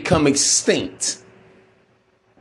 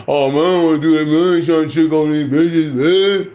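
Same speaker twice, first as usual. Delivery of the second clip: hurried, louder grumble in a put-on voice; mock complaining; 4 dB louder than usual. Told to go out, guys make excuses, mock complaining. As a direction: mimic a reluctant guy's protest. Acting out reluctant guys' complaint.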